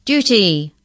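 loud, high official call; crying out. A narrator's reading. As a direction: call it out across a hall. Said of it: "cried the third incisor".

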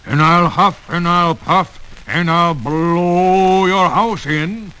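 slow, loud wolf threat; wolf threatening the pig's house; big bad wolf. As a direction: huff it out like a menacing wolf.

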